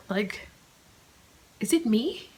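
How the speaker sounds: normal talking voice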